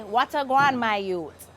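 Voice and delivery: Jamaican patois, guan drawn out; Jamaican saying. Shown as a demonstration. Host teaching the Jamaican greeting in patois. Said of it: "in Jamaica, they say".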